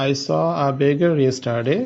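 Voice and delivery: very slow, every word set apart; slow example read. Shown as a demonstration. Teacher dictating an example sentence, very slow. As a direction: dictate it so students can follow. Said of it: "পরেরটাতে আমরা চলে যাচ্ছি".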